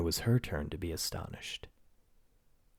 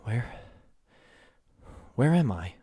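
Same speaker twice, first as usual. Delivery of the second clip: startled waking cry, rising louder; exclaiming; 5 semitones higher, 5 dB louder than usual. Acted. Giantess waking up, exclaiming where she is. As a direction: gasp it out, just woken and lost. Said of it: "she exclaimed".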